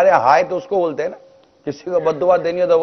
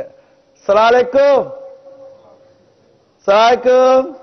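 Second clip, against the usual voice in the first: mock-hasty salaam, high and loud; hurried careless salaam; 7 semitones higher, 5 dB louder, slower than usual. Preacher mocks hurried careless salaams, high and loud. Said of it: "ملاقات ہوتی ہے تو ہم کیا کرتے ہیں".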